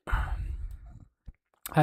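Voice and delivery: slow whispered confession. Real, not acted. Streamer whispers about his notifications, slowly. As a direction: whisper it like a secret.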